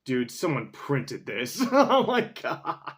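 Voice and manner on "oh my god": laughing disbelief. Real, not acted. Laughing at a printed DVD cover.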